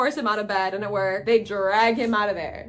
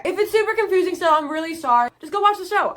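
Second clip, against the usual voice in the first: higher and fast, flustered laughing-off; quick, higher, sheepish apology; 7 semitones higher, 5 dB louder, faster than usual. Real, not acted. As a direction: rush through the apology, a little frantic. Apologising for confusing explanation.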